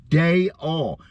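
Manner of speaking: Day all said as two clear syllables, day then all; Day all in two parts. Shown as a demonstration. Showing the audience how his name rolls, day-all. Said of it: "Try it again".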